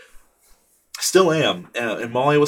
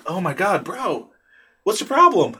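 put-on much higher, frustrated cry at the toddler; exasperated outburst; 9 semitones higher, 4 dB louder than usual. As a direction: voice cracks up in exasperation. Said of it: "and I was like".

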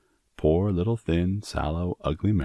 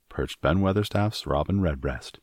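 usual speaking voice

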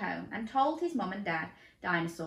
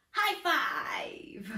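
excited high five shout; 10 semitones higher than usual. Pretend. Reader cheering a high five with listeners. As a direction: shout it back to the kids. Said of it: "Can you give me a high five?".

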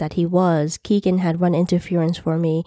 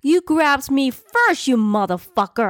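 screaming with rage; 8 semitones higher than usual. A narrator's reading. Woman to man who grabbed her, enraged scream. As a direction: stretch it out in high-pitched rage. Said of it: "I screamed with rage".

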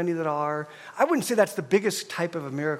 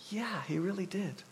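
soft agreement; 10 dB quieter than usual. Retold or imitated. Pastor's quiet reply.

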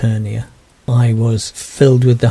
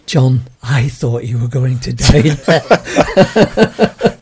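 laughing; slower than usual. Retold or imitated. Minister hugging him, retold.

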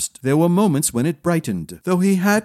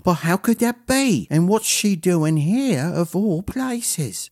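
put-on puzzled boy; 4 semitones higher than usual. Boy's startled inner thought.

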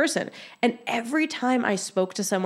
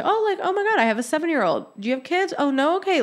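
much higher, bubbly small talk; chatty excitement; 9 semitones higher, faster than usual. Retold or imitated. Acting out friendly chat about kids at a networking event, high and bubbly. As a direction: gush like you just met a fellow mum. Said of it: "we start talking into".

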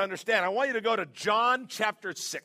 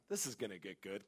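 much softer and lower, under the breath; a private thought he lets the congregation hear; sly muttered aside; hushed inner aside; 10 semitones lower, 14 dB quieter than usual. Retold or imitated. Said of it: "And I'm like".

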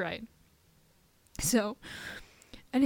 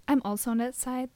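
usual speaking voice

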